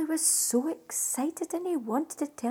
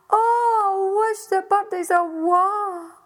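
weeping; 4 semitones higher, 8 dB louder than usual. Acted. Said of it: "weeping and crying out of himself".

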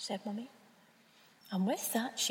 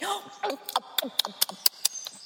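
sharp breathy gasp; excited gasp; 3 semitones higher than usual. Acted. Bird snapping up a caterpillar, sudden gasp. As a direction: gasp as if the urge grabs you.

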